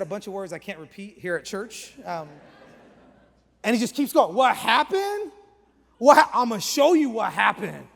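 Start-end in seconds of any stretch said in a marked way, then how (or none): 4.3-7.9 loud, high, imitated belligerent shout; aggressive threat. Angry train rider threatening the storyteller, aggressive. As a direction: escalate it into a threat, acted out big.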